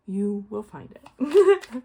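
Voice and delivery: slow, loud laughing reveal. Real, not acted. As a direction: laugh it out, drawn out. Teasing the viewers, laughing.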